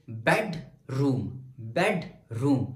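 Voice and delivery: "Bedroom" slowly parted, bed then room; stress on BED. Shown as a demonstration. Teacher asking where energy is, "bedroom" split as BED, room. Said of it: "मेरी energy किस में ज्यादा है".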